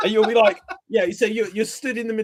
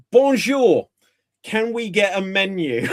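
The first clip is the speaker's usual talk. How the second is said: mock tourist French; 3 semitones higher, 5 dB louder than usual. Tourist showing off to a French waiter, mock French. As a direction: brightly show off your three words of French. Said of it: "So you're like".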